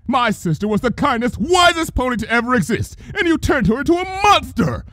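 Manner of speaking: high, ranting anguished fury; furious grief. A narrator's reading. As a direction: rage through your grief. Luna's grieving rage.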